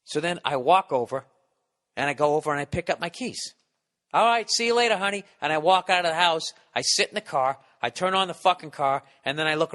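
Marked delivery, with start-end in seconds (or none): 4.1-5.2 breezy goodbye. Retold or imitated. Cheerful goodbye to wife.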